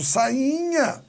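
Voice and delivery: sainha intoned high and drawn out; projected voice. Shown as a demonstration. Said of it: "Eu até imposto".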